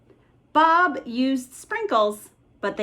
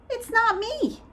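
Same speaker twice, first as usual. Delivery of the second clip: high whiny denial; protesting kid; 13 semitones higher than usual. Acted. Theodore denying he made the noise, high protest. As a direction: insist you didn't do it.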